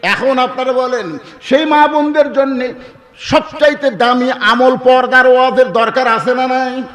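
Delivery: high, forceful appeal to a crowd; rallying preaching. Real, not acted. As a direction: press the question on the congregation at high pitch. Preacher rallies the congregation. (জোর গলায় শ্রোতাদের প্রশ্ন)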